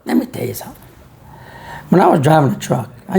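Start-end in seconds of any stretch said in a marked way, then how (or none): 0.0-0.8 "Let me tell you something" dropped low and soft. Shown as a demonstration. Showing the crowd a softer way to say it, low and quiet. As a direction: say it softer and lower, almost confiding. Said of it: "I'm doing like this".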